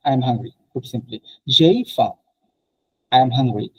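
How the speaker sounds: ordinary delivery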